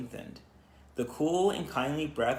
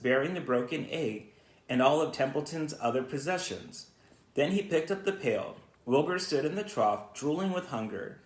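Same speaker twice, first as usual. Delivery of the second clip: ordinary delivery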